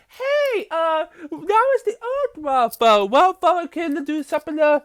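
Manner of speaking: squeaky pleading ninja voice; voicing Kai. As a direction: plead high and urgent like a desperate hero. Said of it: "Kai is trying to tell them".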